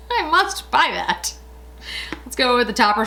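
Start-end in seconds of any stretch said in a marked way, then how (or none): 0.0-1.3 high, slow squeal of mock delight; playful mock-gushing at a shiny thing; dazzled shopper. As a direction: swoon over something sparkly like a magpie. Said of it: "I see things and I'm just like".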